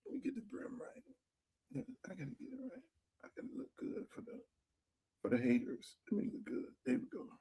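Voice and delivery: whispering under his breath while fixing the brim; a soft private murmur off-mic; muttered aside. Real, not acted. Fixing his cap to himself.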